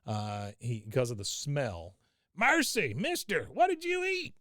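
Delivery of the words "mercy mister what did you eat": high, loud shrieking complaint; Cotton shrieking. Retold or imitated. Cotton shrieking at Bobby over the smell, high and loud. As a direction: shriek at the stink. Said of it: "yelling like Cotton does".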